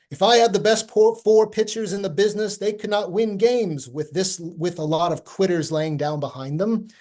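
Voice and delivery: hammy nineteenth-century manager rant; old-timey voice. Retold or imitated. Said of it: "an old-timey voice in my head".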